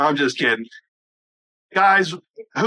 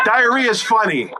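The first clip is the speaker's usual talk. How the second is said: usual speaking voice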